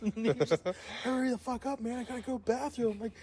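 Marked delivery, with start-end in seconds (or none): 1.0-3.0 high-pitched imitation of an impatient friend; mock urgency. Friend outside bathroom begs prankster to hurry, mock urgency. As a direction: plead in a high, hurried-sounding mock voice.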